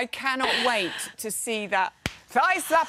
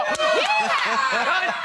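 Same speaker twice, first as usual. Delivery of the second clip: high loud cowboy whoop to rally the room; cowboy yeehaw; 13 semitones higher, 3 dB louder than usual. Pretend. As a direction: lead the crowd in a rodeo yell. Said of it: "Come on, people".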